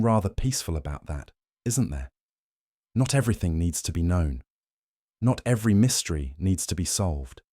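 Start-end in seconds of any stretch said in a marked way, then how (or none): none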